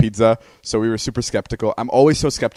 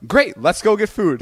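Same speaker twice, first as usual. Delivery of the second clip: imitating brother; 10 semitones higher, 4 dB louder than usual. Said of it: "And then you go".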